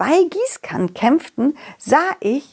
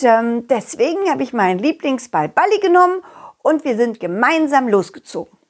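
ordinary delivery

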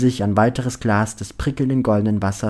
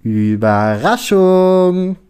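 high, loud, drawn-out cheer; shouted surprise; 8 semitones higher, 7 dB louder, much slower than usual. A narrator's reading. Lennart and Lini surprising Lukas, shouted in chorus. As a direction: yell it slowly like a gleeful chorus. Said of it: "Im Chor rufen sie".

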